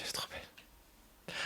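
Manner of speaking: murmure à peine audible, sans voix; chuchoté. Real, not acted. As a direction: chuchoter pour soi, admiratif. Admiring a card softly. (souffle tout bas « elle est trop belle »)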